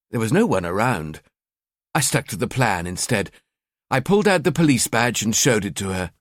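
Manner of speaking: plain reading voice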